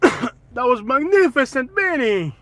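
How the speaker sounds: high, slow, hammed-up exclamation; loud catchphrase shout. Vlogger bursting in on travel chat, slow hammed-up shout. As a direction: stretch it out loud and high, theatrically.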